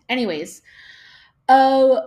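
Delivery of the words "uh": loud high yawn. Real, not acted. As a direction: let out a big yawn. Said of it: "I keep yawning".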